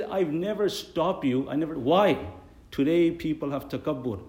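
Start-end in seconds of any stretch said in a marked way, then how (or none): 0.0-2.2 slow, high, insistent; emphatic denial. Retold or imitated. Imam insisting to the muazzin, slow high denial. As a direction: draw out the denial. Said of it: "I said".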